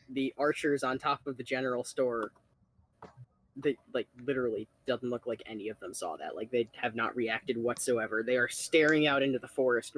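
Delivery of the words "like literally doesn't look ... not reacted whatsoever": ordinary talk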